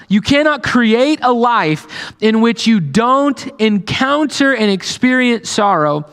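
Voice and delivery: preacher pressing the point; preaching at full force. Acted. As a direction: drive home inevitable sorrow. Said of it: "he's like".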